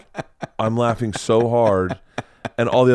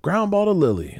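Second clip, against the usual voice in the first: excited coach calling the play, higher; 7 semitones higher than usual. Retold or imitated. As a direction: call out the imaginary play like an excited softball coach. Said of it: "So he's like".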